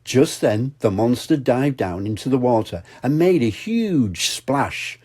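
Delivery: plain reading voice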